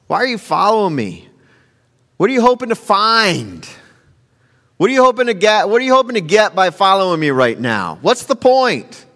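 high-pitched, exasperated questioning; acted annoyance. Jesus to men following him, hammed-up annoyance. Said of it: "ask that question without being annoyed".

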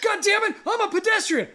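very high, shouted mock outrage; angry scooter rider. Enraged scooter rider yells at driver, furious imitation. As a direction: imitate a furious rider yelling his claim. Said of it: "just fucking angry".

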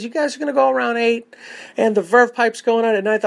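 a hint of a chirpy promoter rattling off set times. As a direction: lightly play the upbeat promoter on the phone. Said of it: "he goes".

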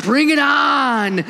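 high, loud, slow thrill-ride yell; excited scream. Retold or imitated. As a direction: shout out the joy of the ride, hands in the air. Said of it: "screaming like".

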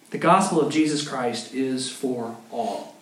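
usual speaking voice

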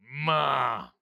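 drawn-out imitation moo; low "ma" sliding into a humming moo; cow mooing. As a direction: do a cow's lowing. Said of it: "she's a cow, so she's like".

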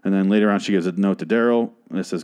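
usual speaking voice

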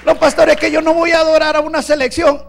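loud, high, driving preacher's cry; a pastor thundering at the faithful about football idols; full-force preaching. Acted. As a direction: hammer it home to the congregation.

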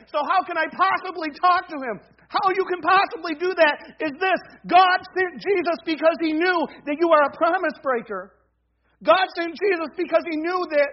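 full-force pulpit proclamation; forceful preaching. Acted. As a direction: preach it hard, hammering each repeated phrase.

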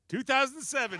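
high, loud answer reveal; game show reveal. Pretend. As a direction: announce it like a quiz host.

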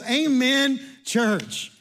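preacher crying out the elder's words to the church; full-force preaching. Acted. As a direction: thunder out the scripture like a herald. Calls amen to the congregation.

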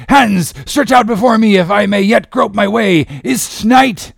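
high, loud, desperate cry; anguished captain. Acted. Ahab groping blindly before his men, anguished cry.

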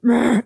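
short, loud mock growl of frustration; imitated inner growl. Imagining Chris's private reaction to the damage, mock frustrated growl. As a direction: voice someone's hidden exasperation as a noise. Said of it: "inside, but that's not what was presented".

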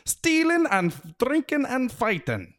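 the wee men yelling with glee; cheerful Feegle crowd shout. A narrator's reading. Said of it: "shouted the blue men cheerfully".

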